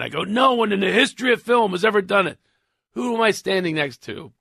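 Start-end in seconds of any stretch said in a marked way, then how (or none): 0.2-2.4 high, emphatic rant re-enacted; raised-pitch replay of a tirade; retold rant. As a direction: declare it as an exasperated rant. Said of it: "I went on that rant".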